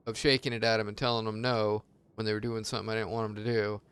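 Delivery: normal talking voice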